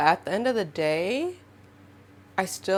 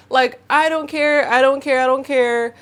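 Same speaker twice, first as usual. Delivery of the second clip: high, loud insistent "I don't care" three times; emphatic repeat; 6 semitones higher, 5 dB louder than usual. Real, not acted. Fed up with victim blaming, loud insistent refusal. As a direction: hammer the refusal home.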